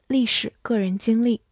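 usual speaking voice

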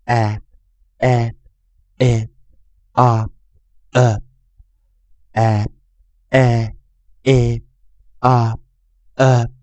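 short a repeated loud; short a drill. Shown as a demonstration. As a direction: chant short a slowly for students. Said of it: "短元音就是".

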